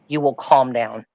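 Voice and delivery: usual speaking voice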